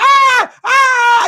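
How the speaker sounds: loud, high wailing "ah"; exaggerated cry of getting smacked; mock crying wail. Comic retelling of the boy she smacked, mock wailing.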